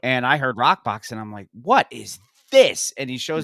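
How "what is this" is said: slow, louder astonished question; lightly acted awestruck reaction; mock amazement. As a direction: stretch it out in retold wonder. Retelling first hearing Rockbox.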